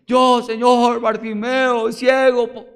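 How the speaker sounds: loud, high answer; eager calling out. Acted. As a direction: shout it out, desperate to be chosen. Blind man answers Jesus.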